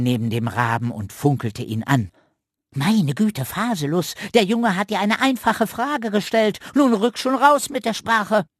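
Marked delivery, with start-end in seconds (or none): 2.7-8.5 much higher, brisk scolding squirrel; high cheeky squirrel, louder at the end; sassy squirrel. Acted. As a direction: tell the raven off cheekily. Squirrel character voice.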